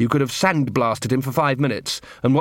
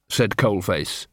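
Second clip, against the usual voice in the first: usual speaking voice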